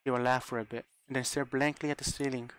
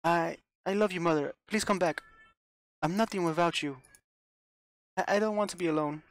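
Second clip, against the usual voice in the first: high, loud begging between sobs; sobbing plea; 7 semitones higher, 4 dB louder than usual. Acted. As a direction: beg Mother not to be angry. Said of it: "Rosa sobbed with grief".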